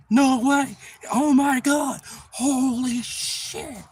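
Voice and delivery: high, loud disbelief, slowing to a drawn-out end; stunned amazement. Retold or imitated. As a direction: gasp in awe at the size. Brother stunned by the fish.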